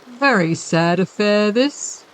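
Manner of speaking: a lightly voiced stuffy-nosed gentleman, slow; odd R's and blocked-nose M's, unhurried; curious accent, head cold. A narrator's reading. Creepy gentleman admires the unconscious bailiff, lightly stuffy and slow. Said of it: "He spoke with a curious accent".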